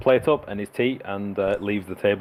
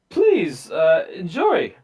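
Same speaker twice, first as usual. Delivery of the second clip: much higher, slow, grand lady's toast; Baroness voice; 9 semitones higher, 5 dB louder, much slower than usual. Acted. Baroness toasting her guests, high grand voice. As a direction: lift it high and theatrical like a hostess. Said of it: "she says".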